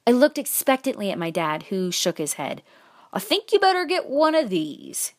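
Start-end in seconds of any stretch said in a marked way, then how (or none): 3.1-5.1 pitched-up dad making a suggestion; high, put-on dad voice; dad character voice. A narrator's reading. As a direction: nudge the kid like a folksy father. Narrator voices dad steering child.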